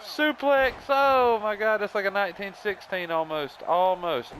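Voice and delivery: high-pitched wrestling call, easing off; excited play-by-play. Pretend. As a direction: react to the suplex like a stunned commentator. Live play-by-play of the match.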